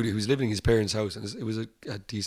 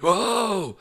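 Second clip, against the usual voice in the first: mock alarmed whoa; 11 semitones higher, 6 dB louder than usual. Ambulance crew reacting to injury.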